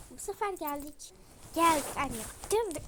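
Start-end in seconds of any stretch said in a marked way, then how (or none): 1.5-2.2 loud yell calling to mom; loud shouted announcement. Acted. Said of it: "ne bağırıyorsun hayvan gibi". 2.5-2.8 loud, higher shout; a child yelling that they arrived. Acted. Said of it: "ne bağırıyorsun hayvan gibi".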